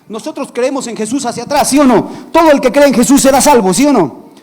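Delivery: loud, driving preacher call; preaching at full force. Acted. Pastor rouses the congregation to answer, loud and driving. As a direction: push it to the back row, fast.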